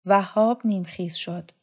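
usual speaking voice

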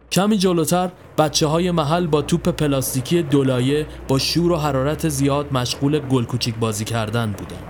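usual speaking voice

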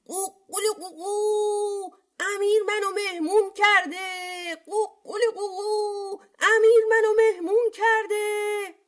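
high sing-song rooster boast; crowing rooster cry. A narrator's reading. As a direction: crow it out with long endings. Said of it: "خروس فریاد زد". (فریاد زیر و کشیده خروس)

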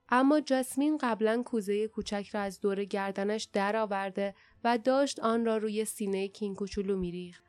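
usual speaking voice

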